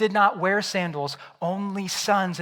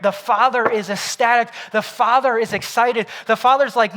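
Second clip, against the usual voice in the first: preaching louder and higher, building excitement; rising preaching fervor; 4 semitones higher, 5 dB louder than usual. Acted. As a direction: whip the congregation up. Preacher builds to full force.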